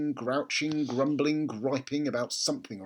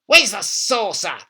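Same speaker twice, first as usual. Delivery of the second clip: put-on slow, higher, louder old woman's command; bossy grandma; 7 semitones higher, 10 dB louder than usual. A narrator's reading. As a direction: lay down the rule slowly. Grandma voiced in read story.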